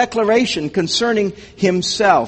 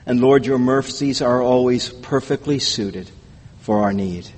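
low, hushed prayer to God; closing prayer; 5 semitones lower than usual. Real, not acted.

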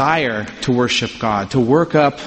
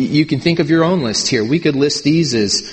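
ordinary talk

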